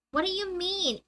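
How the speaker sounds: ordinary talk, nothing marked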